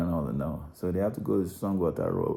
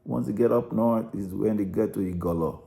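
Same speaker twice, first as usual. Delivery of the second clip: ordinary delivery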